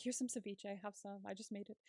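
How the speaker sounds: soft, soothing offer of food; imitated calming. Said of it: "trying to calm him down".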